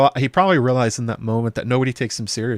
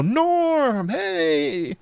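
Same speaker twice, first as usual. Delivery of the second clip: much higher joyful call of the name; Cheers crowd greeting; 9 semitones higher than usual. Retold or imitated. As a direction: cheer the regular as he walks in. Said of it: "it's like when they go".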